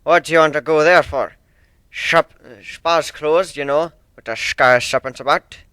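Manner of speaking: put-on loud, stern old sailor with rolled R's; stern sea dog accent. One-winged Pegasus apologising to Fluttershy, stern thick accent.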